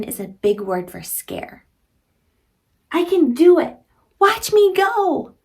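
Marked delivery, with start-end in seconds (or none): 2.9-3.8 higher, louder proud declaration; confident cheer. Acted. Reading confident line to children. 4.2-5.3 much higher, louder and slow; excited boast. Acted. Reading brave line to children.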